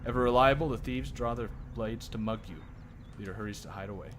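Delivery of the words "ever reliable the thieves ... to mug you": usual speaking voice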